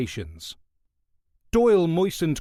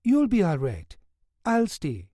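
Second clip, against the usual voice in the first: West Indian nurse; 6 semitones higher than usual. Acted. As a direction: gently coax the guard away. Nurse reassures the guard.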